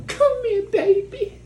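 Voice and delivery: very high squealed mock endearment. Fake-loving relative calling a girl for a hug, hammed-up gushing. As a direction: coo it in an exaggerated falsetto.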